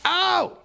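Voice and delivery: sudden pained yelp; sharp high yelp. Real, not acted. Streamer burned by a hot block yelps high. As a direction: yelp like you got burned.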